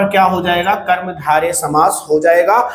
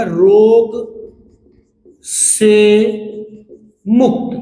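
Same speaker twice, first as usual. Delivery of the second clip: रोग से मुक्त said slowly, a long pause after रोग and after से; stretched apart word by word; much slower than usual. Shown as a demonstration. Teacher to students, stretching the compound open: slow, paused words. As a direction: draw out रोग... से... मुक्त with clear gaps. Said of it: "थोड़ा सा लंबा करेंगे".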